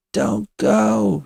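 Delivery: high, drawn-out pleading moan. A narrator's reading. Kitty begs Witch to stay, moaning. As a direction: moan it as a plea. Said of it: "she moans at Witch".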